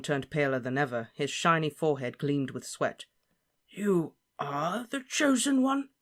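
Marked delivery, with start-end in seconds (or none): 3.7-5.9 much higher, slow disbelieving question; astonished Slughorn. Acted. Slughorn stunned by Harry's claim. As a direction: gasp it in disbelief.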